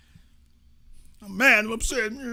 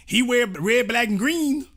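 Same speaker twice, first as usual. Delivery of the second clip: mocking imitation; 8 semitones higher, 4 dB louder than usual. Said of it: "Brother, I don't".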